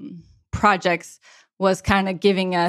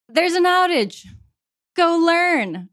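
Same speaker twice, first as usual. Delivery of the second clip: mock boss voice; 9 semitones higher than usual.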